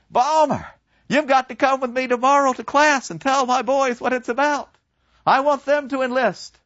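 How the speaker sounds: put-on old teacher pushing his former pupil to recruit; pompous professor. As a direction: rope him into recruiting. Professor Kantorek voiced.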